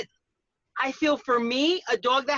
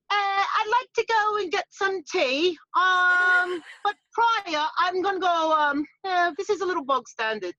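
slow, higher mock posh British accent; hammed-up English toff asking for tea; 6 semitones higher, slower than usual. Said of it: "My turn, my turn".

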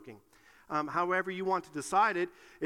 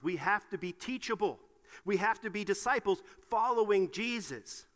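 usual speaking voice

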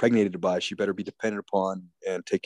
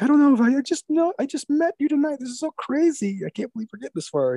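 high breathless-rush mock falsetto; flustered woman imitation; 4 dB louder than usual. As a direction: gush like an overwhelmed woman, pitched way up. Acts flustered woman in film scene.

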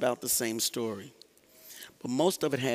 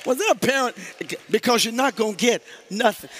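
higher, louder mock parent warning; stern parent; 9 semitones higher, 7 dB louder than usual. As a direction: lay down the law before the store, hammed-up. Parent warning child in store.

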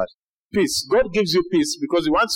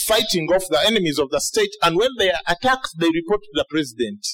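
normal talking voice

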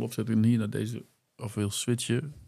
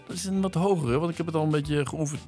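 pitched-up voice effect, higher; 5 semitones higher than usual. Shown as a demonstration. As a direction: speak through a pitch-up filter. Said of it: "dat is de pitch-up".

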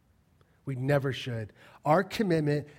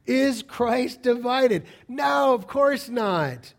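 raised, emphatic preacher's voice; impassioned preaching; 5 semitones higher, 5 dB louder than usual. Real, not acted. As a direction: drive the questions home to the congregation.

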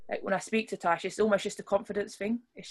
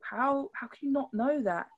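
higher, slow, mildly incredulous question; surprised disbelief; 6 semitones higher than usual. Retold or imitated. Speaker recalling surprise at what people don't know, incredulous. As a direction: stretch it out in mild disbelief. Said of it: "it does surprise me".

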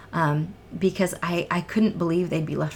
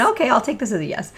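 playful quote; 7 semitones higher, 6 dB louder, faster than usual. Retold or imitated. Retelling her reply to the silent motion.

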